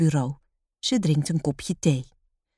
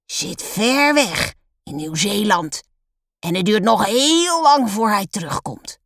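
heavy voice; 4 semitones higher, 3 dB louder than usual. A narrator's reading. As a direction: stretch the key words with stern weight. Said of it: "met haar zware stem".

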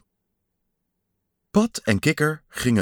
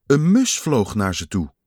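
usual speaking voice

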